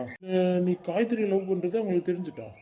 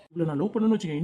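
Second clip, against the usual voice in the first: normal talking voice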